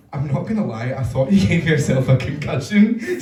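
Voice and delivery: usual speaking voice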